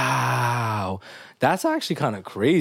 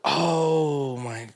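stunned; 3 semitones higher, much slower than usual. Retold or imitated. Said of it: "I was like".

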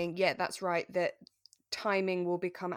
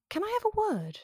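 pitched-up mock soap line; a high, ominous little soap catchphrase; stock soap phrase. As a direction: quote the stock Archers line, high and arch. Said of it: "in quotation marks, "only said".

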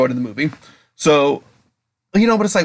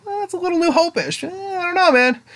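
doubtful fan grumble. Retold or imitated.

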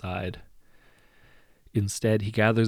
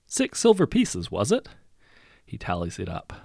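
higher, louder, falsely kind lord; smug lord voice; 5 semitones higher, 4 dB louder than usual. A narrator's reading. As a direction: sweet-talk them into paying more. The hero sets the new tax.

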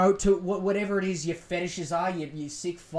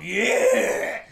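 put-on drunken patriotic yell; 4 dB louder, much slower than usual. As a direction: yell it like a beer-soaked reveller. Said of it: "tinnies just going".

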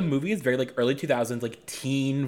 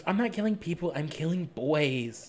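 Jennifer; 6 semitones higher than usual. Acted. Quoting Jennifer's answer to her friend, high and airy. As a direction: pitch it up, then stretch the punchline. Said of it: "talks to Jennifer, which is Megan Fox".

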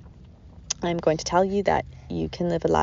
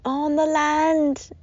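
high, very slow sing-song; 8 semitones higher, much slower than usual. Real, not acted. Mother playing with her baby.

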